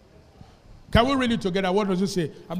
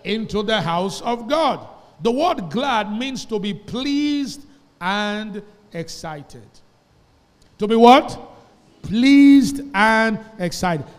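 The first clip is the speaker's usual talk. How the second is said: loud, slow, ringing scripture reading; big pulpit voice, drawn out and loud; preaching at full force; 4 semitones higher, 10 dB louder, slower than usual. Acted. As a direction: proclaim every word to the whole church, slowly.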